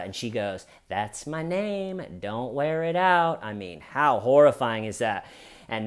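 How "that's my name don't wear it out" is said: higher, drawn-out sing-song taunt; slow, lilting mockery. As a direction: imitate a glib playground tease. Mimicking the taunting suspect.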